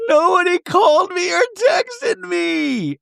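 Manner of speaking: very high, drawn-out imitated wail; mock bawling. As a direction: wail it like a hammed-up breakdown. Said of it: "Brittany bawling".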